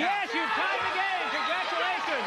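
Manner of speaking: high, loud cheering congratulations; excited host. Real, not acted. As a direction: cheer the tie like a game show host. Host cheers tie game.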